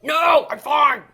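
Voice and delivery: drawn-out, loud mock exasperation; exasperated shout. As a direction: shout it slowly, fed up. Exasperated reply to old ladies.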